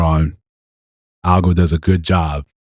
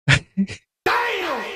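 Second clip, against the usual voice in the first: laughing disbelief; 7 dB quieter than usual. Real, not acted. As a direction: burst of laughter into a high, shocked "Damn!". Shocked reaction to the story.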